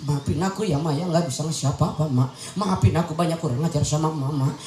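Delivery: acted low, subdued pleading to a mother; pleading apology. Child apologising to mother over a small gift, low pleading. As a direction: beg forgiveness like a contrite child.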